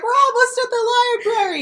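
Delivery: mock cheerful reassurance. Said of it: "and she's like".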